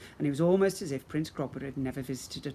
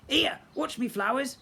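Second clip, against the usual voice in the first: a grumpy old local, higher voiced; grumbling; 10 semitones higher, 3 dB louder than usual. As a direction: grumble it at the goblin from the crowd. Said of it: "Mister Crocombe grumbled".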